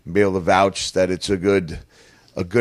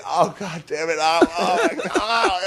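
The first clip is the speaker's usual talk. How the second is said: higher, strained cursing in pain; groaning curses from the floor; retold pained cursing; 8 semitones higher than usual. Wrestler lying hurt on the ground, high pained cursing. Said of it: "I'm cursing".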